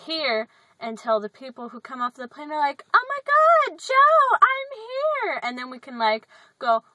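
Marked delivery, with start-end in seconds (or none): 2.9-5.4 much higher, loud excited squeal; excited greeting. Retold or imitated. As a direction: squeal with joy at the pickup. Imitating arriving passengers.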